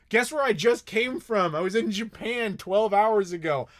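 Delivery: smug mock shout. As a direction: rub it in their faces. Retold smug reply to family.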